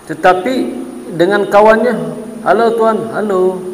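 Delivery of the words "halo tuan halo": lightly voiced sweet phone greeting; a gentle hello to a friend; polite soft greeting. Greeting his friend on the phone, mildly sweet and polite. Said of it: "Oh, lembut".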